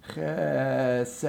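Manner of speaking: Chesed with the final D clearly placed; crisp final D. Shown as a demonstration. Teacher to choir, showing the final D. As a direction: land the D on a short rest. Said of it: "put the D on a little eighth rest".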